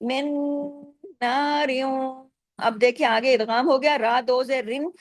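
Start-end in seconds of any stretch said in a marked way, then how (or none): 0.0-2.2 slow "मिन नारियों" with long nasal hums; chanted "नारियों", ending drawn out through the nose; big ghunna. Shown as a demonstration. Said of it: "बड़ा गुन्ना भी होगा".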